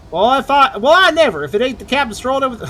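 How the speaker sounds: mock-scandalized Southern character; southern accent. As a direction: read it in a hammy Southern accent. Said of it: "I'm getting a southern accent out of that".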